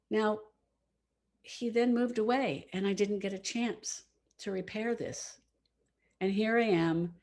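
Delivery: plain reading voice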